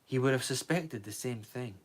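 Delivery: ordinary delivery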